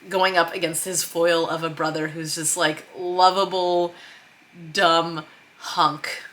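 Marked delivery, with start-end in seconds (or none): none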